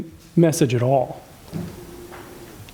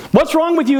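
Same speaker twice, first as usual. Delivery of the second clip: biting accusation, pitched up high; mock attack; 9 semitones higher than usual. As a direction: fling it as an attack, not a question. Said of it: "isn't a question".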